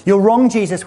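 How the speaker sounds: hammed-up indignant refusal; defiant retort. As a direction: throw it back at Jesus like a stubborn child. Voicing the self-willed believer.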